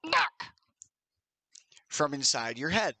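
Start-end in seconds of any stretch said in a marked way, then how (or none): 0.1-0.4 high, abrupt warning cry; quick high-pitched burst; sudden warning. Acted. Game master voicing a warning heard in the player's head, sudden and high. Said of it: "You hear the words".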